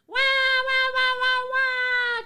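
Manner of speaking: put-on high, loud sing-song baby wail; mock crying wah. Mocking her critics as crybabies, with a high, loud sing-song wah. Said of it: "all these people do is whine".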